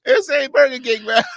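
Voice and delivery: high laughing cry; crying with laughter. Real, not acted. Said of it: "laughing repeat".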